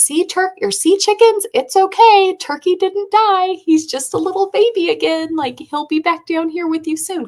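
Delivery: put-on very high, loud singsong kid voice; high-pitched little girl comforting animals; little child voice. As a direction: sweetly reassure like a five-year-old. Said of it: "that was her, like, little baby brain".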